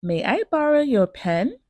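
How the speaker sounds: polite request with "pen" sweeping upward; rising intonation. Shown as a demonstration. Teacher modelling a request, rising on "pen". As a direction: end high, pitch rising on "pen". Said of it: "sentences with a rising intonation".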